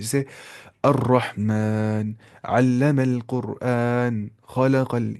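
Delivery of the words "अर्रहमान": अर्रहमान with a full heavy r, slow. Shown as a demonstration. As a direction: say अर्रहमान slowly with a thick rolled r. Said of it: "मोटी आवाज़ से". (मोटी आवाज़ से अर्रहमान)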